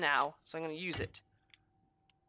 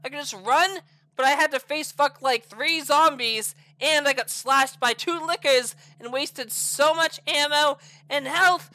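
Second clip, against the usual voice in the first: normal talking voice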